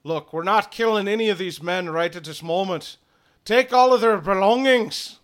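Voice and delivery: commanding order. Acted. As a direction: order the men like a captain. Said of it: "He says".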